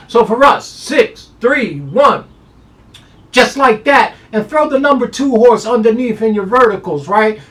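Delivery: high, loud hyped-up call; excited hype. Real, not acted. Handicapper pumping up his top pick, loud and high. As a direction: shout out the top pick like a showman.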